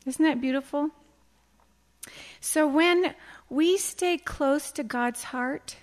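ordinary talk, nothing marked

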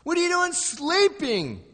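high, loud, alarmed reproach; frightened disciples. Acted. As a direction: cry out to wake the sleeper. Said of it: "who are becoming very frightened".

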